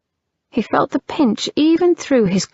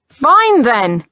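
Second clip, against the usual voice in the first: annoyed shout; 4 semitones higher, 5 dB louder than usual. Acted. Brother shouts and leaves.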